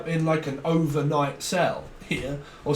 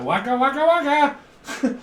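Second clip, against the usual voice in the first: waka voice; 9 semitones higher, 4 dB louder than usual. Retold or imitated. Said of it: "but like".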